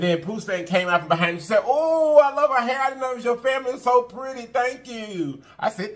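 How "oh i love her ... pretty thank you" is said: imitating a gushing lady, pitched higher. Retelling how the lady praised Pooh Stank's hair, high and gushing. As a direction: gush over the hair like the sweet old lady. Quoting the lady's compliment.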